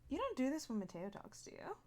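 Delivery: usual speaking voice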